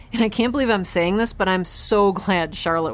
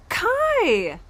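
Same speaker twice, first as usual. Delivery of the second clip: excited gasp; 13 semitones higher than usual. Acted. Overjoyed to see robot friend.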